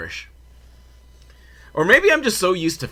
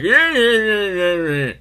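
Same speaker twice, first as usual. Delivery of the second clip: high wordless gibberish whine, sliding down; mock jeering noises; 7 semitones higher, 5 dB louder, slower than usual. Hooligans answering back, high mock noise instead of words. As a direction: make a high nonsense noise in place of the quote. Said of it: "I make a lot of noises in place of actual quotes".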